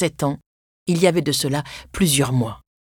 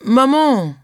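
put-on higher-pitched little boy's plea; mildly flustered child calling mother; mumbled child's protest; 6 semitones higher, 4 dB louder than usual. A narrator's reading. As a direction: plead with mother, lightly acted. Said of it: "bredouilla Thésée".

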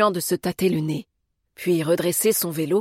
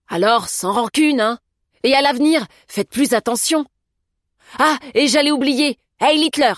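higher, louder character voice; breezy, raised-voice send-off; unfazed Augusta; 7 semitones higher, 7 dB louder than usual. A narrator's reading. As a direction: call out a cheeky parting shot. Narrator voices Augusta's parting shot.

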